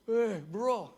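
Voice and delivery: high, loud, distorted greeting; put-on strained hospital-bed voice; injured friend imitation. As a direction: mimic a battered patient struggling to talk.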